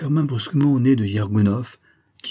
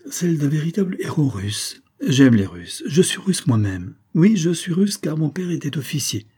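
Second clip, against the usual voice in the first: put-on playful girl's voice, higher; 3 semitones higher than usual.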